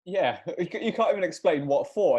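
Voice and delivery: normal talking voice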